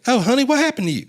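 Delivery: high, quick Southern nurse fussing over a patient. Retold or imitated. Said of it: "Maybe I didn't do the accent".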